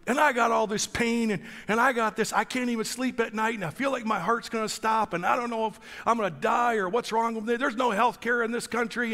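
high-pitched litany of woes; hammed-up bellyaching in a high voice; mock complaining. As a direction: imitate someone pouring out grievances. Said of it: "complaining about his country".

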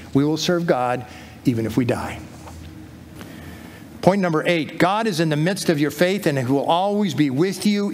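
ordinary delivery

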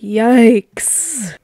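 loud, startled yikes. Real, not acted. Player reacting to a creepy message, loud yikes.

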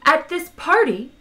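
high, insistent push onward; persistent. A narrator's reading. Arthur persists with his party story, insistent. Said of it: "persisted Arthur".

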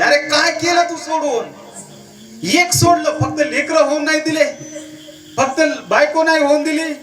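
high-pitched rhetorical challenge; preaching rebuke. Acted.

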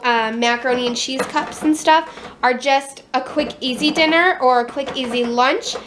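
usual speaking voice

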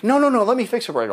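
high, fast rush to offer help; eager to help. Retold or imitated. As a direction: jump in quickly to fix it. Eager staffer offering to fix.

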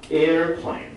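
slow American English "airplane", two syllables, AIR-plane; American "airplane" drawn out clearly; 美式发音慢读 "airplane". Shown as a demonstration. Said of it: "美国人叫airplane".

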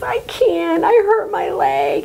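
put-on a hurt child pleading up from the ravine; scared little girl. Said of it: "Elaine was so scared".

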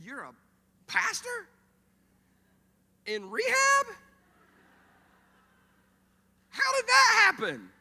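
high, loud disbelieving yell; hammed-up imitation of a stunned friend; incredulous shock. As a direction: shriek each question in mock astonishment. Acting Nick's disbelief at pastor.

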